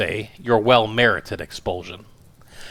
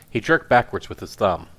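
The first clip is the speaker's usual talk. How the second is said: usual speaking voice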